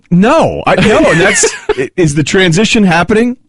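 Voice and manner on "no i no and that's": laughing, pitch jumps up. Real, not acted. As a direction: laugh through the words. Flustered laugh at question.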